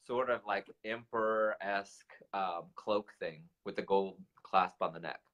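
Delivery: ordinary talk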